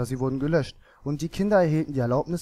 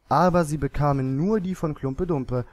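plain reading voice

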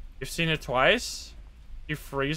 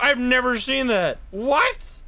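blown away by what happened; thrilled amazement; 8 semitones higher than usual. Real, not acted. Amazed by the ghost event.

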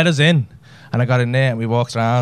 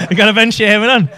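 laughing; 6 semitones higher, 5 dB louder than usual. Real, not acted. Mocking audience member laughing.

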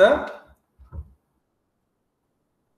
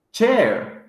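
ordinary talk

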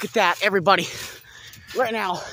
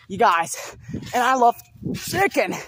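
ordinary delivery

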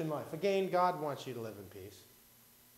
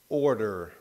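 ordinary delivery

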